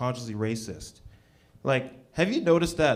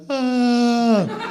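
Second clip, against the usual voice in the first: loud, high, drawn-out mock cheer cry; deaf cheerleader imitation; 9 semitones higher, 5 dB louder than usual. Comic imitating deaf cheerleaders answering a cheer, mock cry. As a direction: hold one high, loud vowel.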